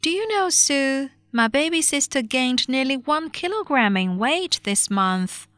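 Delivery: put-on high-pitched, cheerful girl's line. A narrator's reading. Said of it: "said to Susan cheerfully".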